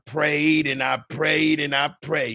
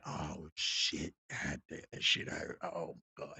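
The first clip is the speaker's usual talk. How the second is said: low, soft, breathy pained groans; hurt friend moaning; 9 semitones lower, 13 dB quieter than usual. Retold or imitated. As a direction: whisper it through the pain. Wounded friend groaning in pain.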